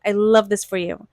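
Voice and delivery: ordinary talk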